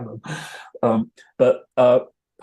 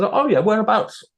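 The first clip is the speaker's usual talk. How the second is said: much higher, louder, keen curiosity; eager question; 11 semitones higher, 5 dB louder than usual. Retold or imitated. Paul asking where he comes from, eagerly and much higher. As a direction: leap up with interest.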